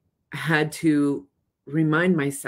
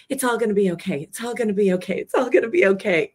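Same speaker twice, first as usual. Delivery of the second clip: a hammed-up, higher "it's all gonna be okay" that builds; mock forced reassurance; 6 semitones higher, much faster than usual. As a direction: chant it higher, pushing harder each time. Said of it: "When we're going".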